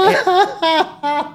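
usual speaking voice

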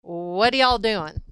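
slow Southern "What are y'all doing?" with "y'all" drawn out; Southern drawl. Shown as a demonstration. Host performing her accent on request, slow Southern drawl. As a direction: drawl it slowly, Southern style. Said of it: "come on with your accent".

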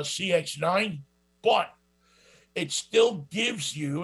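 normal talking voice

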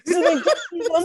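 normal talking voice

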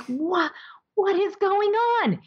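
very high, slow, exasperated disbelief; mock bafflement. Boss baffled that her employee doesn't care about the raise, very high. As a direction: act totally baffled at the reaction.

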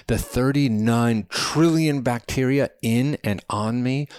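high, insistent rant, slowing at the end; Chadwick, impassioned. A narrator's reading. Man tells companion his cells vanish, impassioned. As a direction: punch out each loss, then draw out the close.

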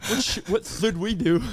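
"should" said with a lisped th for sh; mock lisp. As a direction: imitate the lisp on "should", turning sh into th. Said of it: "I have a lisp".

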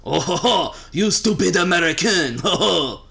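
loud, high, hammed-up Frenchman; mock French accent with a haughty "ho, ho". Podcaster demonstrates a French accent for co-host, hammed-up French. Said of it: "let me do a French man accent".

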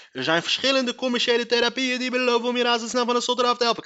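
rapid-fire showing-off read; heel snel lezen. Real, not acted. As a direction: rattle it off to flex your fluency. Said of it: "door alles heel snel te lezen". (opschepperig snel voorgelezen)

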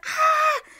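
high, loud terrified scream; Mariana's imitated scream; huge scream. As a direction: let out a sudden shriek of fear. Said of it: "rompe así en un grito enorme y empieza a gritar".

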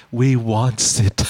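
low, slow imitation of a sexy creature; Gollum. Host mimicking the guest, sexy Gollum imitation. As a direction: draw it out low, teasingly creaturely. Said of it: "she sound like sexy Gollum".

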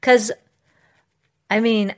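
normal talking voice

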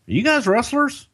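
high, curious cop voice; mock cop asking; cop's question. Cop questions them in retold story.

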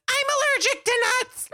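high, fast, hammed-up shriek; mock a kid yelling in alarm; alarmed student yell. Student cries out in story.

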